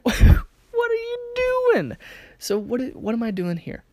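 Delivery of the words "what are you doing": very high, loud, stretched-out mock squeal; imitated shocked outcry. Speaker imitating friend just licked on the ear, shocked squeal. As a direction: draw it out in disbelieving mock shock.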